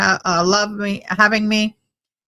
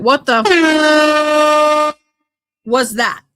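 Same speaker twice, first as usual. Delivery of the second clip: startled what the; 7 semitones higher, 4 dB louder, much slower than usual. Real, not acted. Reacting to something weird.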